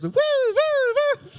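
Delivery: very high, loud, slow woo-woo siren; party ambulance siren. Pretend. Ambulance reimagined as a party, high loud slow siren woos. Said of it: "sound like the most fun vehicles".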